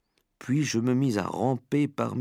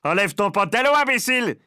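high, loud exasperated order; shouted jeer; 9 semitones higher, 5 dB louder than usual. A narrator's reading. Onlooker mocking the frantic cook, shouting. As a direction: yell advice at a fool. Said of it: "cria quelqu'un".

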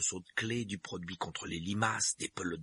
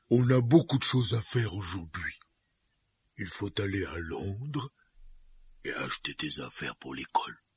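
giant voice; 4 dB louder than usual. Acted. Hagrid voiced by narrator.

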